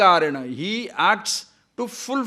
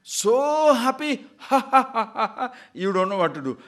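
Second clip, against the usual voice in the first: higher, laughing, playing a giddy happy man; 7 semitones higher, 4 dB louder than usual. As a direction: laugh like someone overjoyed for no reason. Acting a giddy happy person.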